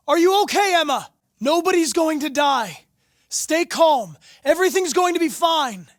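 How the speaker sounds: loud, high, urgent reassurance across a rooftop; calling out to reassure. Acted. Negotiator calling to the hostage girl, loud urgent reassurance. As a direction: shout comfort to a frightened girl, keep it steady.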